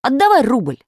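high, forceful order; stern demand. A narrator's reading. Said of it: "сурово сказал".

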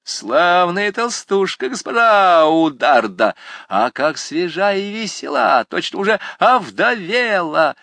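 high, jeering taunts, drawn out; cheeky student taunting the librarian. Acted. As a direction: tease him mercilessly from up on the pillar. Jean taunts Munier.

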